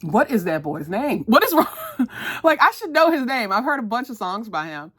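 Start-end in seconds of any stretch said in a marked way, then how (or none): 0.0-1.7 loud, rising outburst of frustration; exasperated. Real, not acted. Vlogger can't recall a singer's name, loud exasperation. 2.4-3.5 loud, high-pitched self-reproach; exasperated. Real, not acted. Vlogger annoyed she forgot a singer's name, loud exasperation.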